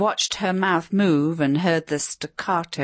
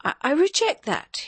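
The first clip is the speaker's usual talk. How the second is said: slightly shaky, higher protest; quavering voice; 10 semitones higher than usual. A narrator's reading. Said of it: "in a slightly quavering voice".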